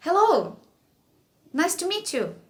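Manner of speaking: mock stiff teacher greeting a toddler; stiff greeting to a child. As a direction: greet a toddler like an adult stranger. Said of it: "E ele falou".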